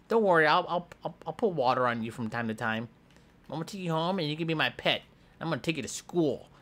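ordinary talk

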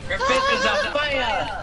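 high, slow announcer cry; excited announcer. Acted. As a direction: call it out like a hype man. Announcer voice from game.

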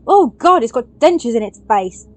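loud gasp, then appalled exclamation; horrified shock. Real, not acted. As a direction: recoil in horror, loudly. Sees monster's face.

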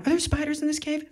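the panic sneaks in; sudden worry. Acted. Mid-meditation, a panicky thought about spiders.

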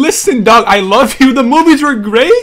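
loud, high, frantic pleading at a teammate; exasperated shouting. Real, not acted. Gamer yelling at teammate John, exasperated shout.

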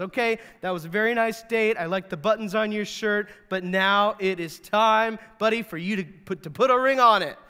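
mock-demanding, building to a loud finish; playfully insistent. Woman telling her date to propose, playfully insistent.

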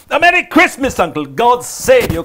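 high, loud festive greeting; jolly nephew bursting in with good wishes; cheerful. A narrator's reading. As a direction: call it out cheerily. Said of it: "cried a cheerful voice".

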